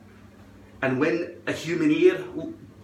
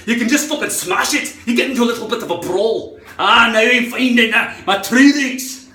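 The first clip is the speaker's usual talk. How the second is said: loud, high, worked-up Scottish rant; angry Scot; 7 semitones higher, 7 dB louder than usual. Acted. Riled Scot smashing the computer, angry and loud. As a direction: shout it with rising fighting fury. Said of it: "if I speak like that angry".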